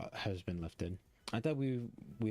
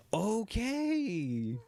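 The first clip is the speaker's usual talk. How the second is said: high, loud drawn okay; overwhelmed okay; 12 semitones higher, 4 dB louder than usual. Real, not acted. Streamer reacting to dark backstory, high and overwhelmed. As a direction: squeak it out, overwhelmed.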